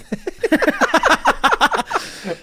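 high, loud laugh bursting out; loud burst of laughter. Real, not acted. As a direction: crack up at the punchline. Laughs at Timbuktu reveal. (högt skratt)

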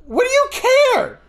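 high, forceful shout; retold shout. Shouting back at the aunt, high and forceful. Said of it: "I'm paraphrasing half my sentence".

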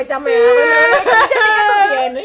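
mock-frantic retelling of a drunken night; loud laughing retelling. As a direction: half-shout the story while cracking up.

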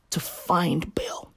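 slow, soft conspiratorial whisper. Real, not acted. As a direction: lean in and whisper it. Drops to conspiratorial hush.

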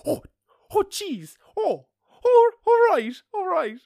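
put-on very loud, high old-lady fluster; old woman. Old woman surprised by his birthplace, loud high mock voice. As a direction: imitate an old woman taken aback, shooting way up. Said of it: "this very old woman next to me".

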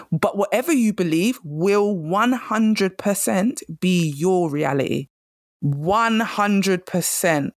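each word slowed and weighted; slow emphatic. Real, not acted. Host stressing belief to listeners, slow and emphatic. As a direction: drive the point home slowly. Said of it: "emphatic point".

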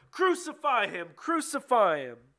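high, loud crowd chant. A narrator's reading. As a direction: shout the demand, drawing out the repeat. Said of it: "they shouted all the louder".

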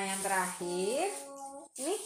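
normal talking voice

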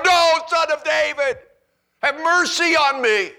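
high, very loud pleading cry. Acted. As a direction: beg at the top of your voice. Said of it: "all the more".

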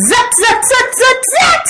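high, staccato zaps; playful sound effects. A narrator's reading. Froggy gets dressed, high playful zaps. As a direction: rattle them off high like little zippers.

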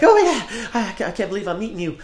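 hammed-up overeager date; yappy chihuahua. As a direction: yap it out like an excited little dog. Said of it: "like a little chihuahua".

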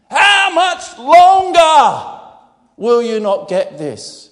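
loud, slow, drawn-out cry of frustration; how much longer, shouted; exasperated shout. Shown as a demonstration. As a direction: shout it out in despair. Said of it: "He didn't say that. He just groaned".

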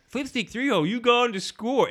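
high-pitched mock teammate calling a play; Diz. Imitating a teammate during laser tag, high mock urging. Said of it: "And then Diz like".

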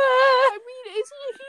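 mock wail. Mock crying outburst.

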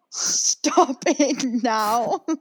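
very slow, laughing through the words; begging them to stop while cracking up; drawn-out giggling stop it now; laughing plea. Retold or imitated. Cringing at a celebrity clip.

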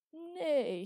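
high, loud whinnying nay; horse neigh. A narrator's reading. Father playing mule for his daughter, loud high horse neigh. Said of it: "imitating a horse and stamping his foot".

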